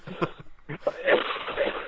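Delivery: a coughing fit off mic. Real, not acted. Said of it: "oh, sorry, I'm gonna cough".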